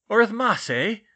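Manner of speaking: loud, high archaic outburst; shouting portrait. A narrator's reading. Medieval portrait reacts to the subject's name, shouting. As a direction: shout it incredulously in an old-world accent. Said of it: "the portrait shouted".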